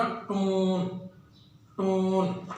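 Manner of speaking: "टोन" with a long, held "oh"; slow teaching repetition of "टोन"; long vowel. Shown as a demonstration. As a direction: stretch the o in each "टोन". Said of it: "इसकी long sound है".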